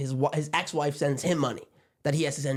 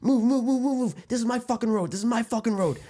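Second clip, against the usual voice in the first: high, urgent driver's outburst; imitated road rage; 8 semitones higher than usual. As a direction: act out an aggressive driver claiming the road. Driver confronts oncoming car head-on.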